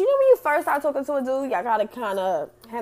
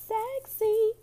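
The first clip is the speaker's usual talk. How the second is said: "Sexy" sung out much higher; 10 semitones higher than usual. Shown as a demonstration. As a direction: lift "Sexy" up high and playful.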